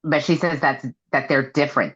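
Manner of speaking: usual speaking voice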